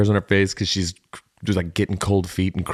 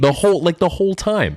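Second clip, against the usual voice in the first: excited squeal; 11 semitones higher, 3 dB louder than usual. Real, not acted. Gleeful James Marsden reveal.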